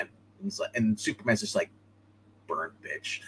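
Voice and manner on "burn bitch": low, quiet deadpan threat; low cool quip. Retold or imitated. Superman quip voiced in retelling.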